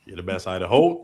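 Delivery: normal talking voice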